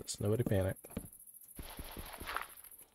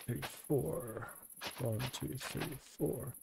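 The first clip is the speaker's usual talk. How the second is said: ordinary talk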